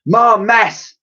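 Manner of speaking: high, loud exasperated exclamation; Dotty exasperated at the new damage; Dotty muttering. A narrator's reading. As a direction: grumble about the mess. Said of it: "muttered Dotty".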